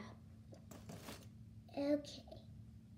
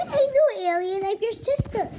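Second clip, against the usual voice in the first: loud, high indignant protest; exasperated outburst; 4 semitones higher, 6 dB louder than usual. Acted. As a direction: insist on it, fed up. Child protests sister's robot act.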